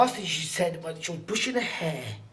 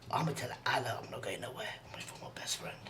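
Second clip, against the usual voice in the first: quick hushed whisper, much lower; whispered promise; 6 semitones lower, 11 dB quieter, faster than usual. Retold or imitated. A dying girl whispers her promise to her best friend. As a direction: whisper the promise like a secret vow.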